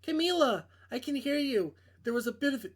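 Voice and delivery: a hint of a nervous young woman; girl voice. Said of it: "I can't do girl voices".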